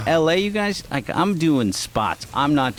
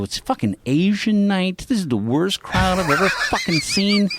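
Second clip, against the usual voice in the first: higher ranting, laugh breaking in; heated rant; 8 semitones higher than usual. Retold or imitated. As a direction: rail at the crowd, half laughing. Ranting at the crowd.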